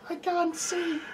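put-on high squeaky kid crying out; a panicked little kid, very high; blind child voice. Voicing blind child on box.

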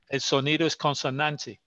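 ordinary talk, nothing marked

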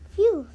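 relieved phew. Acted. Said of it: "princess relieved".

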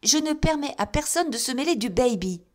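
high, offended haughty retort; wife rebuffs her husband, haughty and offended. A narrator's reading. Wife haughtily rebuffing her husband, high and offended. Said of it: "dit Missis Pocket".

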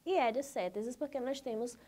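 normal talking voice

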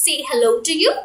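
usual speaking voice

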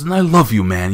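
a big hammed-up bro declaration of love; Joseph Park voice. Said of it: "Joseph Park turned and he goes".

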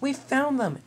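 high-pitched joyful exclamation; excited cry. A narrator's reading. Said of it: "cried Thomas".

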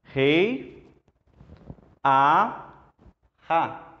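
"Rei, a, ra" said slowly, syllable by syllable; slow teacher syllable reading; spelling out syllables. Shown as a demonstration. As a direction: sound out each syllable. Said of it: "vamos ler essa palavra aqui".

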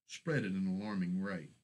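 ordinary delivery, nothing marked

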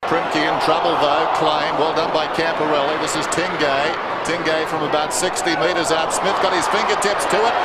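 loud fast sports call; live play-by-play. Real, not acted. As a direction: keep it rolling like live footy radio. Live play-by-play at full pitch.

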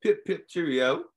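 a hammed-up posh British "Pip, pip, cheerio"; lightly played British toff, rising at the end; mock British accent. Joking to a jailed man, in a mock British accent. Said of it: "I can get a U K accent".

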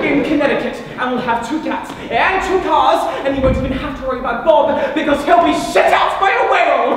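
loud, rising rapturous gush; carried-away fantasy. Acted. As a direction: build excitedly to a shouted finish. Carried away by fantasy of married life.

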